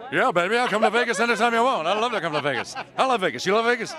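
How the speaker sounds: loud, high, laughing Vegas gush; excited gushing. Real, not acted.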